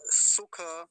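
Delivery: सुख said loud and slow as su-kha; full final vowel. Shown as a demonstration. Teacher repeating the correct word, full final vowel. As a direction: punch and stretch सुख into two syllables. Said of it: "लेकिन सुख नहीं बोलेंगे".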